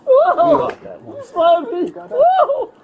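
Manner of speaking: high, mocking laughter; laughing. Real, not acted. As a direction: laugh through the words at them. Mocking laughs while detained.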